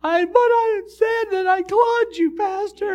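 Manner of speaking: high, loud comic wail; mock bawling. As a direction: imitate a woman blubbering with happiness. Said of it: "She's bawling. She goes".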